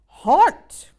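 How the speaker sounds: high, loud cried word; ghost dad's cry. Acted. Ghost father cries to his ghosties, loud. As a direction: call it out like a spooky ghost dad. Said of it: "cried the dad".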